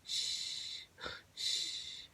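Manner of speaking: soft breathy "shh" snores; quiet sleeping-animal snore; friends snoring. A narrator's reading. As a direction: snore in hushed exhales. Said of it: "his friends snore on".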